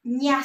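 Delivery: ña said as "nyah"; one syllable ña, N gliding into Y; ña sound. Shown as a demonstration. Teacher showing the tilde letter's sound, ña as "nyah". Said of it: "it's gonna make the".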